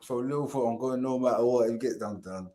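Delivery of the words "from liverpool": normal talking voice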